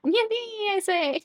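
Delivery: high, loud-ish yippee; playful cheer. Pretend. Streamer sending a viewer off to nap, playful cheer.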